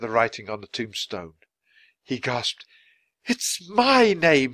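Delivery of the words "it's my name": slow, high, loud cry of shock; horrified cry. A narrator's reading. Man reading his own tombstone, crying out. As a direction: draw it out in horror. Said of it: "he cried".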